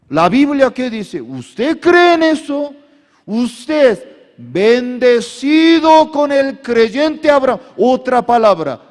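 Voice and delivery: loud, high fervent preaching; preaching at full force. Acted. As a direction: preach it out to the crowd, rising. (predicación a todo pulmón)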